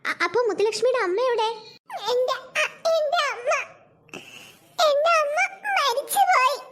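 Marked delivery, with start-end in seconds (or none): 2.1-6.6 tearful. Acted. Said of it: "കരയാതെ".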